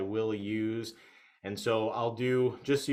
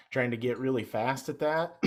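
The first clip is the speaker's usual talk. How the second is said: ordinary talk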